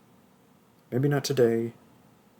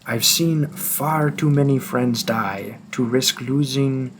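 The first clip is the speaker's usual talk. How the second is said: put-on French accent. Hunter forcing the listener to come along, French accent. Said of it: "tried to do a French accent".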